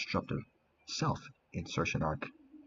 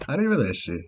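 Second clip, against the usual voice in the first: theatrical farewell; 6 semitones higher, 6 dB louder than usual. Acted.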